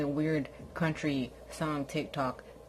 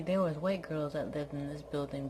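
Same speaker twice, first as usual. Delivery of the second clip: ordinary talk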